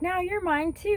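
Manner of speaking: very high, squeaky toy-dog voice; high cartoon Pekingese voice, playful and possessive; little dog voice. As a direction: pipe it up like a fluffy lapdog claiming you. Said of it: "and he's like".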